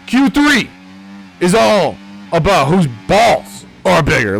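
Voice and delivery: a sudden high, loud jump on Q three; hammed-up hype. Tipsy commentator hyping Q three to viewers, hammed-up. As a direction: sell the crude joke like a ring announcer.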